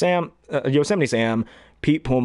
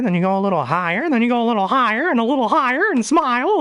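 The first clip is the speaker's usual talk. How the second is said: each phrase a step higher, usual voice to falsetto; climbing higher; 12 semitones higher than usual. Shown as a demonstration. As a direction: raise the pitch phrase by phrase. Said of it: "raise your voice, then you go a little higher".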